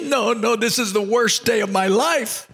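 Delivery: hammed-up tearful lament; mock crying. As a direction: play the weeping city slicker. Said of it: "that city slicker starts crying".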